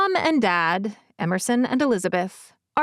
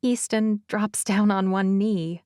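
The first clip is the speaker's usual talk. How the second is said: usual speaking voice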